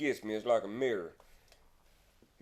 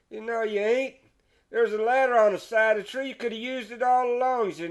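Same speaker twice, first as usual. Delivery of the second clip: higher teasing scold, slowing at the end; scolding Joe; 6 semitones higher than usual. Acted. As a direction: tell him off with a grin.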